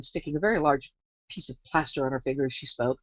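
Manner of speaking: usual speaking voice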